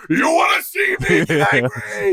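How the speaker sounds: high, slow, hammed-up anime menace; anime voice-actor impression. Imitating an anime kid snapping at bullies, dub-style anger. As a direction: overplay it like an anime dub villain. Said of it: "acting like he's in a, like, a recording booth".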